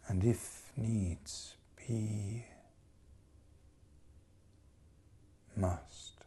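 very slow line fading into a whisper; hushed resolve. Acted. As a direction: draw it out, then breathe the last word. Slows then whispers.

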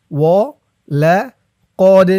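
ولقد drawn out very slowly, ق bounced hard; linger, then jolt the ق in ولقد; qalqala demo. Shown as a demonstration. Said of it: "ধাক্কা দিয়ে পড়তে হবে".

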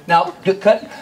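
usual speaking voice

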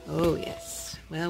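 usual speaking voice

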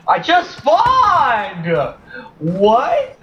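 high drawn-out outraged cry; outraged whine. Real, not acted. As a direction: whine at the unfairness. Outraged at spawn kill.

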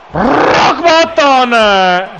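drawn-out, high announcer call; wrestler move callout. Pretend. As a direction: stretch it like a ring announcer.

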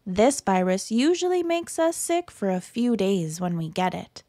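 ordinary delivery, nothing marked

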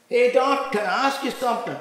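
high, loud call to the doctor; eager hailing. Retold or imitated. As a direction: flag someone down eagerly.

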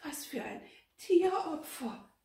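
high, loud, frightened question; fearful, trembling high voice. A narrator's reading. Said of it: "fragte Gertrud mit zitternder Stimme".

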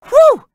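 high loud whew of relief; big exhale of relief; loud relieved whew. Acted. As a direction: blow out the relief. Relief after rescue.

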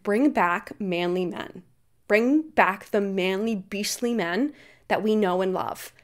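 slow, mock-earnest plea for manly men; slow mock-pious voice; mocking conservative women. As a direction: lightly parody a prim conservative lady. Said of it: "these conservative women are always like".

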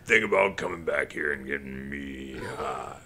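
slow, loud drawn-out stoner call; weird voice. Retold or imitated. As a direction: stretch every word out, sinking low by the end. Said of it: "this, like, weird voice".

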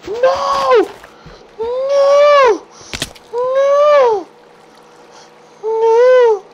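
high, loud panicked shrieks; panicked screams. Real, not acted. As a mob attacks him, high panicked screaming.

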